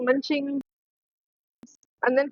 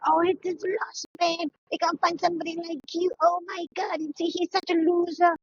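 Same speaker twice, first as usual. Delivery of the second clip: higher gushing bestie; a friend hyping up her dumped bestie, higher and gushing; mock hype bestie; 4 semitones higher than usual. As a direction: gush flatteringly at your friend. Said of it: "Without going like".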